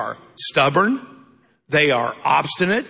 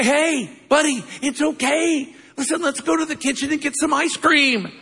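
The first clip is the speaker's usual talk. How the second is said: much higher, bright promise of ice cream; excited grandpa; 9 semitones higher than usual. Retold or imitated. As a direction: sell the treat with eager excitement. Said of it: "That's what I say".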